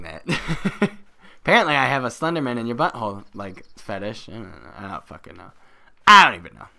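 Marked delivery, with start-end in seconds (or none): 6.0-6.7 sudden loud outburst; exasperated yell. Pretend. Player baffled by his own fetish joke, exasperated yell. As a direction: burst out in mock exasperation. Exasperated after muttered same words.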